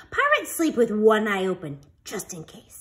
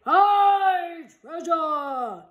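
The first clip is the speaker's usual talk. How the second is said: slow drawn-out crew cheer; crew shout; 6 semitones higher, 3 dB louder, much slower than usual. A narrator's reading. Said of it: "the others shouted".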